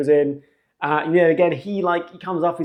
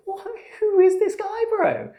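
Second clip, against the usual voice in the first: disbelieving squeak. Retold or imitated. Baffled thought about the applicant.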